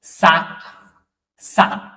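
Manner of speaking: साक said slowly and carefully, twice; French example. Shown as a demonstration. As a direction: clear model word साक. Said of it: "इसके बाद".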